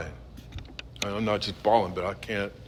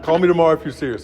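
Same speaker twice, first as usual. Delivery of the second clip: fast, higher, louder, pushy; imitating the young punk; 8 semitones higher, 9 dB louder, much faster than usual. Young punk telling him to call if serious, fast and pushy. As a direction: rattle it off like an insistent kid. Punk's firm reply, voiced.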